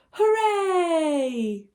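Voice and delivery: bright cheer. Acted. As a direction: cheer for the happy ending. Happy ending cheer.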